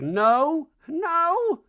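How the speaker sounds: high, slow hammed-up Reba; lofty slow mock-mom rant. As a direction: drone it like a grand old dame. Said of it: "She's like".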